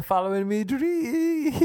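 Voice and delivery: high, slow, "dreams" held very long; mock dreamy proclamation. Emigrant asked what he is up to, high mock dreamy wail. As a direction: hold the last word like a silly siren.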